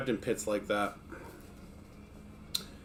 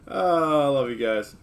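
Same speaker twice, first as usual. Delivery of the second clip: usual speaking voice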